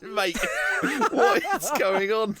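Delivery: high, slow, laughing question; giggling mock outrage; laughing disbelief. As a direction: laugh through the baffled question. Retold text, laughing.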